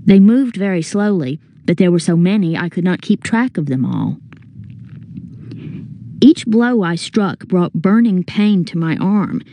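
ordinary delivery

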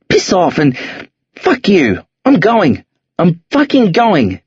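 put-on a scared boy boiling over, voice breaking upward; frightened teen lashing out, voice cracking; voice cracking in anger. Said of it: "My voice cracks and breaks".

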